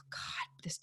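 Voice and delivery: sighed, whispered dismay; exasperated whisper. Retold or imitated. Driver facing a dingy, terrible store, exasperated whisper. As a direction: breathe it out under a sigh.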